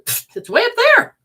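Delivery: high, loud, scoffing brush-off; loud mocking "Pfft" pitched high; dismissive mock. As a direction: scoff as if spirit were far away. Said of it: "and said".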